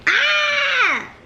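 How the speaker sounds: high startled yelp of laughter; ticklish shriek. Real, not acted. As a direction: squeal as if tickled. Dog tickles her.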